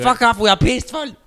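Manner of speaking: put-on high, loud German-accented rant; belligerent German guy. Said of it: "Whoa, German guy, calm the fuck down".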